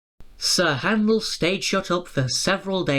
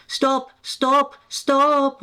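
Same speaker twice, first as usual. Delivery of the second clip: higher, louder wail; 9 semitones higher, 4 dB louder, much slower than usual. A narrator's reading. Coaches wailing at Peter Sam, loud. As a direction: cry out for him to stop. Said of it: "wailed the coaches".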